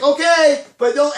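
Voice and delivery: high, loud parental reply; mom calling back. Retold or imitated. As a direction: holler back a warning to the kid. Mother calls back to child.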